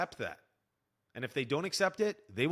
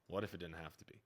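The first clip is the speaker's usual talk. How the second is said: softer and lower, dropping the ad voice; toned down; 8 semitones lower, 10 dB quieter than usual. Shown as a demonstration. Coach redoing his line without the infomercial tone, quiet. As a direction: say it again quietly, no selling. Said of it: "Sounded like an infomercial".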